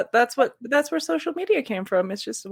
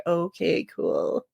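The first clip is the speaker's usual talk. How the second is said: deadpan dismissive; 7 semitones lower, 3 dB quieter, much slower than usual. Retold or imitated. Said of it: "everybody would be like".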